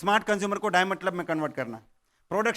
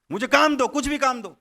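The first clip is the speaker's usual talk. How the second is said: loud threat; 5 semitones higher, 5 dB louder than usual. Acted. Ghost threatens farmer for work.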